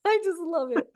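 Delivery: high, delighted laugh through the words. Real, not acted. Delighted by two sides of the same coin, laughing. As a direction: burst out laughing as you say it. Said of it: "I'm laughing because I love".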